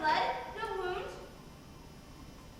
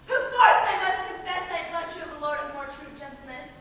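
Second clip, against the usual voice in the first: normal talking voice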